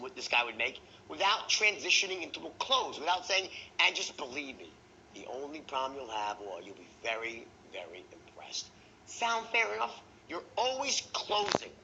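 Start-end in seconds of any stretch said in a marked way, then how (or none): 3.8-8.7 closing tonality. Shown as a demonstration. Salesman closing a prospect, low hushed sincerity. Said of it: "these three tonalities". 9.1-10.0 "Sound fair enough?" lifted high and even, as a mild question; reasonable man tone. Shown as a demonstration. Salesman checking the prospect agrees, reasonable lifted "Sound fair enough?". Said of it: "That's the reasonable man tone".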